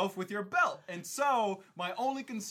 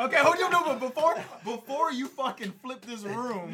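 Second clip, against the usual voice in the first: much higher and louder cutting in; urgent interruption; 6 semitones higher, 8 dB louder than usual. Real, not acted. Cutting in before the others blame him, urgent loud. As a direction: jump in to stop them. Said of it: "interrupting to defend himself".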